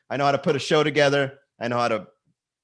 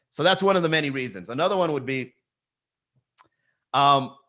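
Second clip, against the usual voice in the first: normal talking voice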